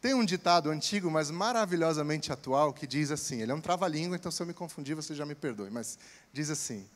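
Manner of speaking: normal talking voice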